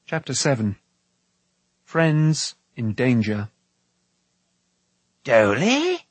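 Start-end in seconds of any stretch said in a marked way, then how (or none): 5.2-6.0 high, rising question; incredulous upward squeak; astonished echo. A narrator's reading. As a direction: echo it in sheer disbelief. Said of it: "echoed the astonished bard".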